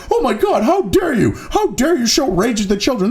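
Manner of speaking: sarcastic mimic of hysterical critics; mock outrage. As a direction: clutch your pearls and whine it. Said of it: "And now you're like".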